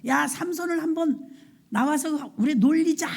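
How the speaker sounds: high-pitched, gloating taunt; mocking call. Nobles egg each other on to mock Samson, jeering. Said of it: "조롱하는 거예요".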